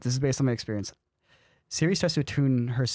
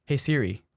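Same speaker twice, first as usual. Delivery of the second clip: "Hey, Siri" with falling pitch; "Hey, Siri" in an even voice, tune going down; downward inflection. Shown as a demonstration. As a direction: let "Siri" drop at the end of "Hey, Siri". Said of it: "I have a downward inflection".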